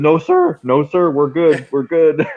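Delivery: high, eager yes-sir voice; nervous pleading. Retold or imitated. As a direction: sound like a big guy backing down fast. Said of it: "He goes".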